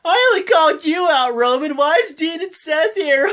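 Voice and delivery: high, whining complaint; whiny Miz. Retold or imitated. Miz complaining to Roman about Dean and Seth, high whiny voice. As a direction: whine like a sore loser. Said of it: "He's whining like normal".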